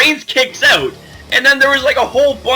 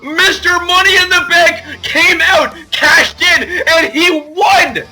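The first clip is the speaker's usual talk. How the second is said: hyped announcer call; 6 semitones higher than usual. Retold or imitated. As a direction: belt it out like a ring announcer crowning the champ. Recaps Ambrose cash-in like announcer.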